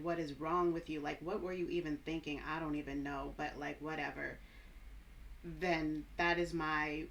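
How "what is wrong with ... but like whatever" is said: low, dry, annoyed sarcasm; dry tone drop. Shown as a demonstration. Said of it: "dry tone drop where I talk like this".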